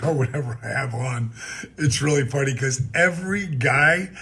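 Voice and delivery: ordinary talk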